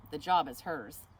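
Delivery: ordinary delivery, nothing marked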